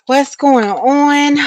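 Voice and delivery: breathless from running upstairs; panting greeting after the stairs; out of breath. Real, not acted. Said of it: "I am out of breath".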